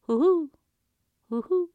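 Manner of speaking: high owl hoots calling back. Acted. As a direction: hoot back like an owl flying off. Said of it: "calling back".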